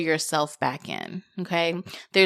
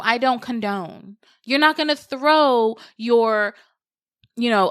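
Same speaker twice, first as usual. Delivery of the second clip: ordinary talk, nothing marked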